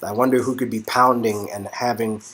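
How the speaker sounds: ordinary talk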